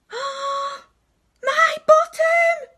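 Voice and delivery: high, loud, drawn-out wail; crying out. Acted. Robot Bernard discovering his bottom is gone, loud wail. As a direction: howl the loss slowly and high. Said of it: "cried Bernard".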